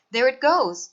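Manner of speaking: put-on boy shouting as the baby swims off; excited shout. A narrator's reading. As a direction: call it out as it dives. Boom spotting the baby again.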